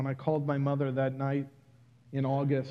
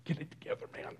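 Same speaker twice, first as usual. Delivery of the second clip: whispered pep talk to himself; whispered self-talk; 12 dB quieter, much faster than usual. Retold or imitated. Man telling himself to hold back tears, whispered. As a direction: hiss it under your breath.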